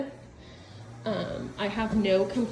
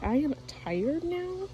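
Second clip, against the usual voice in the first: slow, slightly higher dog voice; voicing the tired dog; 5 semitones higher, 5 dB quieter, slower than usual. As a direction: lightly act out a worn-out dog, drawing it out. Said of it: "He's like".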